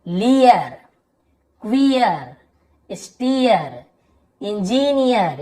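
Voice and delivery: लियर to इंजीनियर, each ending stretched to ee-yar; drawn-out ईयर ending. Shown as a demonstration. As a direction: model one word at a time, with pauses. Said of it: "यहाँ पर भी देखिए ईयर ऐसे". (हर शब्द के अंत में ईयर खींचकर)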